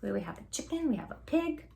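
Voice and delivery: ordinary delivery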